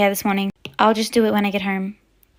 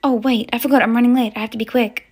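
ordinary talk

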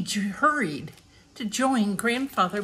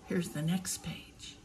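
soft whispered aside; 12 dB quieter than usual. Real, not acted. Reader telling the child the page turns, whispered. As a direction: lean in and whisper it.

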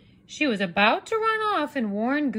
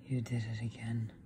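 much lower, softer whisper; weary father whispering; tired hushed reproach; whispered Papa; 11 dB quieter than usual. Acted. Papa sighs at chicken.

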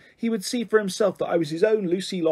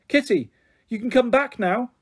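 calling out; 6 semitones higher than usual. A narrator's reading. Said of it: "I called".

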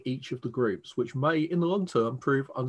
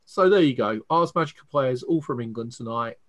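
ordinary delivery

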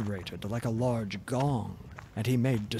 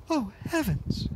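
much higher frightened princess cry; 12 semitones higher than usual. A narrator's reading. Princess sees the ogre coming, frightened cry. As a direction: gasp it out in alarm. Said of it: "cried out Tingaling".

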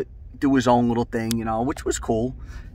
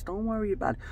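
high, hushed soothing voice; soft reassurance; 7 semitones higher, 10 dB quieter, faster than usual. Retold or imitated. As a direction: imitate an older relative calming you down. Father-in-law calming heated groom.

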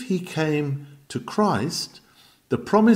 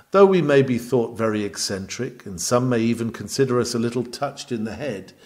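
ordinary delivery

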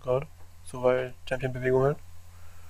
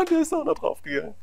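much higher, laughing through it. Real, not acted. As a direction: talk through a laugh of glee. Laughing at a double kill.

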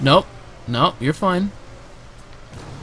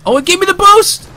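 excited yell; 8 dB louder than usual. Real, not acted. Excited reaction to a boost.

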